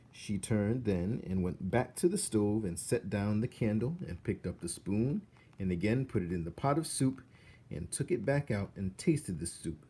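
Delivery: plain reading voice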